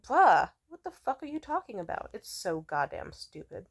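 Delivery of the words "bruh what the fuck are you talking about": high, loud disbelieving retort; exasperated, what-are-you-on tone; high loud mock disbelief at pushy men; incredulous bruh. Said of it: "and then it's like".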